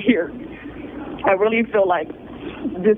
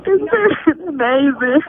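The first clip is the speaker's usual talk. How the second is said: higher, slow, tearful voice collapsing into a long sob; breaking into sobs; 5 semitones higher, slower than usual. Real, not acted. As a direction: choke up on the words, then break down. Tearful thanks, breaking down mid-plea.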